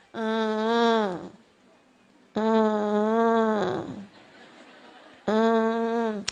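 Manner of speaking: low droning fake whimper; drawn-out low hum mimicking a fussing child; imitated child whining. Preacher imitating a child's unserious crying, low drone. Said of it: "ada anak nangis gini nih".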